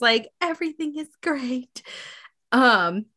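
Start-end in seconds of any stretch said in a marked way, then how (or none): 0.4-1.8 higher, softer fake-bright line; understated forced cheerful voice; mock forced cheer. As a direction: smile through tears, lightly. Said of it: "But she's still crying".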